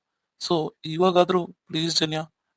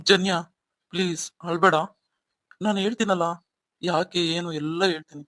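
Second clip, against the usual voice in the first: ordinary talk, nothing marked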